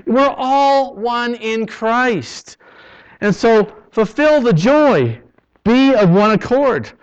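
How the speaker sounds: loud preaching; full-force preaching. Acted. Pastor urging her church: not one church against another, loud preaching. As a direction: proclaim it to the whole church, rising.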